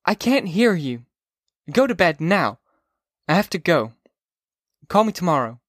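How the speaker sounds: put-on high, sharp girl's voice; a girl woken by a drunk caller; young woman Sally. A narrator's reading. Sally answers drunk Holden, annoyed.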